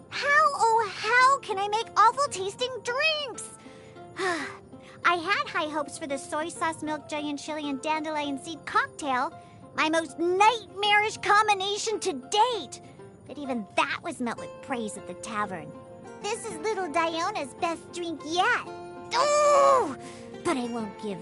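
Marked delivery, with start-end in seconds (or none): 0.1-3.4 high, theatrical lament; melodramatic despair. Acted. Despairs at making bad drinks. 19.2-20.0 high, loud drawn-out whine; wordless squeal of frustration; frustrated wail. Acted. Frustrated at her delicious drink.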